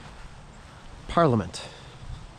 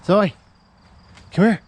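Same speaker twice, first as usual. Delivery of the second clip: high loud call to a dog; 10 semitones higher, 12 dB louder than usual. Real, not acted. Calling the dog.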